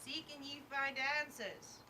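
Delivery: high, loud call from afar; calling across the room. Acted. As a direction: shout it across a big room. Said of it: "You hear Lorraine just from across the room".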